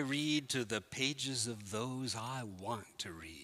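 recited Wolfe passage. Acted. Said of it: "treffend geschildert".

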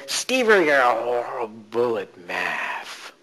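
very slow, drawn-out critic voice. Shown as a demonstration. Coach demonstrating the old voice, drawn out slowly. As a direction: stretch each word out slowly. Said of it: "start the voice".

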